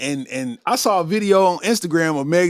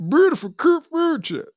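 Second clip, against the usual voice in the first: higher, mocking Memphis accent; 7 semitones higher than usual. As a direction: repeat it mocking her accent. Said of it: "with that Memphis accent".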